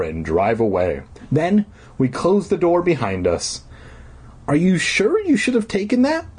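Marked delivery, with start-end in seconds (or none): 4.5-6.3 high, uneasy question; worried girl. A narrator's reading. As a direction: ask it like a nervous sister. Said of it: "I asked Elliot".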